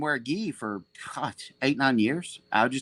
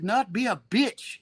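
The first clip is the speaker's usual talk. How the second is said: higher, slow, foreign-voiced order; Seneca impression; 8 semitones higher, slower than usual. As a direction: command him slowly like a master. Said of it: "I got a good Seneca impression".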